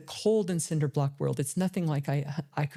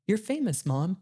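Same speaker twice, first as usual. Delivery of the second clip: lightly voiced little girl, pitched higher; a hint of a child's higher voice; seven-year-old daughter; 4 semitones higher than usual. Daughter sees her mom on the news, lightly imitated child. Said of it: "my seven-year-old daughter goes".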